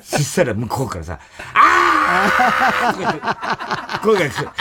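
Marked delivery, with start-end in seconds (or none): 1.5-3.0 high, loud offstage mock yell; mock shout. The boss heard from the other room, a high mock yell. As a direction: yell it from across the flat.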